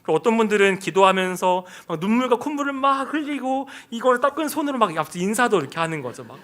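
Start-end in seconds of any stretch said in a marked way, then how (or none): none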